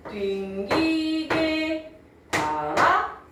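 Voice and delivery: "빙기게" held very long and high, "달아" rising; 중세국어 성조 낭독. Shown as a demonstration. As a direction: intone "빙기게 달아" slowly, syllable by syllable. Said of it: "이렇게 읽었다는 거야". ("빙기게"를 높고 길게, "달아"는 올려서)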